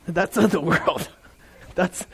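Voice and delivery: talking through a laugh. Real, not acted. Preacher amused by own worldly impression, laughing. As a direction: let laughter break into the words.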